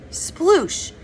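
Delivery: high, squeezed single word; strained voice. A narrator's reading. Zero names it while working on the jar, strained. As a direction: strain lightly, as if twisting a stuck lid. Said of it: "His voice was strained as he worked on the jar".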